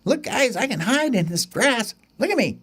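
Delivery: high, bouncy chick voice; excited little bird. Acted. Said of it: "And he goes".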